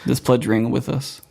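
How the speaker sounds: usual speaking voice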